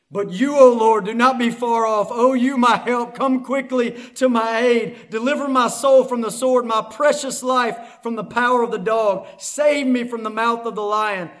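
loud, driving scripture reading; urgent plea. Acted. Preacher reading the psalm's prayer for deliverance, loud and urgent. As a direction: call out to God for rescue.

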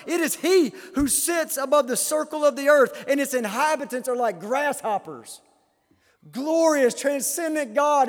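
loud, high declamation of scripture; impassioned preaching. Real, not acted. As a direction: thunder the questions at the congregation.

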